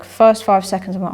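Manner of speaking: ordinary delivery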